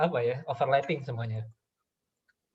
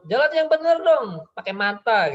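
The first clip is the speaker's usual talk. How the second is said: put-on much higher, loud yell at the child; angry mother yelling; 9 semitones higher, 7 dB louder than usual. Mother yelling at her child who knocked over the water jug, angry. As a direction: snap at the kid, full volume. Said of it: "Kata ibu tuh".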